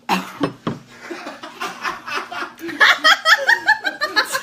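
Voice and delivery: loud high laughing fit; big burst of laughter. Real, not acted. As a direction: crack up uncontrollably. Wine tasting goes wrong.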